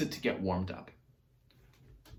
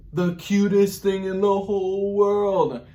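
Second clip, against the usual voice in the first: gushing; 5 semitones higher, 6 dB louder, slower than usual. Acted. Gushing over cute Baby Owl.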